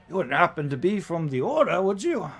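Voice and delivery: high, loud, theatrical question; put-on coachman voice, curious about the passengers; inquisitive warforged coachman. Coachman figure questions the party.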